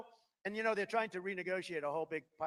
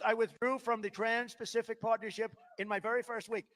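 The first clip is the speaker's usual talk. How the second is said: ordinary delivery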